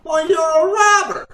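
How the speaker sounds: high, loud accusing yell; indignant outburst. Acted. Arguing over a deal for half interest, indignant shout. Heated argument on the phone.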